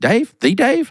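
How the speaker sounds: high, startled double take; the caller suddenly realizing who is on the line; surprised. Retold or imitated. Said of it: "And he said".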